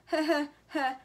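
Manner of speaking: loud, acted "heh heh heh"; gloating chuckle. A narrator's reading. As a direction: chuckle at a brother caught out. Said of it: "chuckled Mike".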